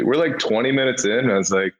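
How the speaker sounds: ordinary delivery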